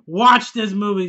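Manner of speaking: loud, slow order to the viewers; loud command. Real, not acted. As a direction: insist they go watch it now. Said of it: "If you haven't seen".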